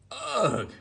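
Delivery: loud, high disgusted choke; choking ugh. Acted. Teddy Bear tasting a leaf, choking loudly in disgust. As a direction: gag on the leaf. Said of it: "choked Teddy Bear".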